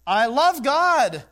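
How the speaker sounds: high, loud, slow mocking "I love God"; sing-song the empty claim; mock hypocrite. Preacher mocking a hypocrite's boast, high and slow.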